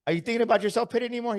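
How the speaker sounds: quick question. Retold or imitated. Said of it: "Tony questions the guy".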